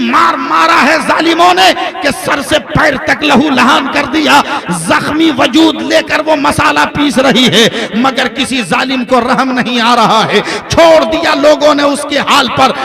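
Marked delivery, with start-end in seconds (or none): none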